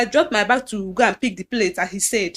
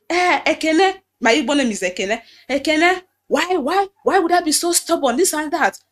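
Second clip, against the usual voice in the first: pastor scolding; 6 semitones higher, 4 dB louder than usual. Retold or imitated. Pastor calling her, scolding.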